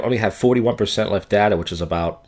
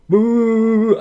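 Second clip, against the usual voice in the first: high, loud, held "woo" noise; loud sing-song woo on one high note; imitated wooing; 6 dB louder than usual. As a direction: mimic a guy's taunting high wail. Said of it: "I hear him go".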